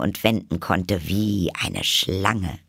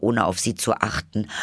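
ordinary delivery

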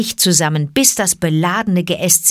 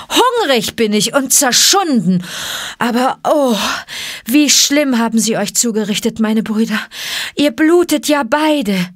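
put-on high-pitched boy voice full of dismay; excited, upset child, rising in pitch; distressed boy; 5 semitones higher, 3 dB louder than usual. A narrator's reading. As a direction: cry out over your hurt friends. Mowgli dismayed at friends' wounds.

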